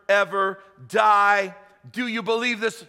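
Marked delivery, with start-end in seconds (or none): 0.1-1.5 ever die stretched slow and loud; drawn-out emphasis. Acted. Preacher to the congregation, stretching the promise slow and loud. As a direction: lean hard on ever die.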